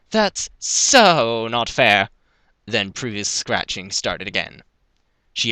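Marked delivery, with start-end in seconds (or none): 0.1-2.1 loud, drawn-out complaint; loud protest. A narrator's reading. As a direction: wail it slowly as a protest. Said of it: "There was a loud".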